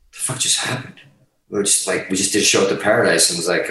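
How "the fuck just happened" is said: breathless hushed disbelief; stunned whisper. Retold or imitated. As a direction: whisper it in shock. Band stunned by record deal.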